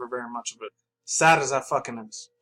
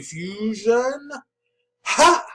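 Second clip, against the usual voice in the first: slow build to a sudden high, loud "Ha!"; fusion dance shout; 8 semitones higher, 4 dB louder, much slower than usual. Retold or imitated. Fan acts out the cartoon fusion move, ending in a shout. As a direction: act out the cartoon fusion call with a big yell at the end. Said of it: "Like that".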